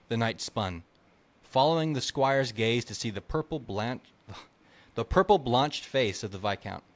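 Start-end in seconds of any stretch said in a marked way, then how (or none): none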